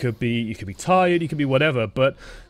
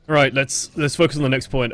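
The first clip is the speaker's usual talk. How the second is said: ordinary delivery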